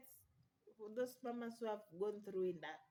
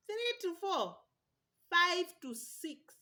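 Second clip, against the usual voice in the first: higher and louder, last count slow and strained; mounting pain; 8 semitones higher, 9 dB louder, slower than usual. Retold or imitated. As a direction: climb the count as the pain builds. Counting labor pain stages.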